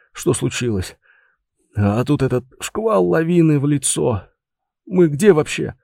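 lightly voiced dazed man; a hint of a groggy, hurting man; dazed brigadier.

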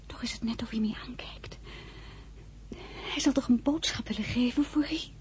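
soft unvoiced whisper; hushed wondering whisper by the patient. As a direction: whisper anxiously to yourself. Whispering at the sickbed.

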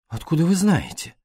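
put-on young woman startled that the detective knows; surprised. A narrator's reading. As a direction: caught off guard, asking sharply. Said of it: "удивлённо спросила Пилар".

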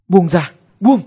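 high, loud panicked demand; kêu cứu hoảng hốt. Acted. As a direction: shout it while struggling free from a grip. Vùng vẫy khi bị bóp cổ. (tiếng quát hoảng hốt, vùng vẫy)

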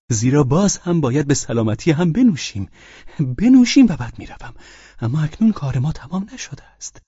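high, loud opening outburst; agitated insistent drunkard. Acted. Drunken guest refuses to leave, agitated insistence.

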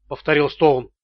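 ordinary delivery, nothing marked

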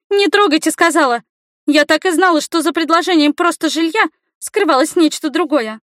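put-on high, loud girlish outrage; accusing girl. A narrator's reading. Girl protests and accuses the man.

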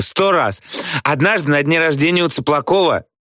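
high-pitched eager bragging; boastful. A narrator's reading. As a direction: brag like an excited girl. Люська хвастается выпивкой перед всеми. (хвастливо, высоким голосом)

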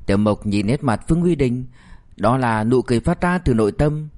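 usual speaking voice

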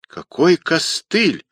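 high, drawn-out indignant question; flaring up. A narrator's reading. Rodionov reacts to being called crutch, indignant. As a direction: bristle at the nickname. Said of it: "взвился Родионов".

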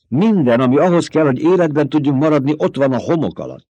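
high, loud, fast protest; desperate outburst. A narrator's reading. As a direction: throw the words at her in despair. Despairing son argues with mother.

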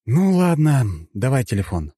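resigned, sighed-out consent. A narrator's reading. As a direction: give in with a sigh. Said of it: "вздохнул я".

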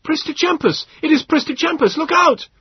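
high, loud shout of alarm; alarmed cry. A narrator's reading. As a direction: yell a warning to the crowd. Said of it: "The cry went up".

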